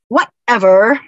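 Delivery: loud, dismissive teen imitation; mock teenager. Speaker borrows teenagers' favorite retort, mock dismissive. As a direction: toss it out like a teen, rising at the end. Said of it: "what so many teenagers love to say".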